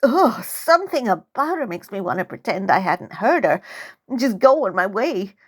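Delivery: ordinary delivery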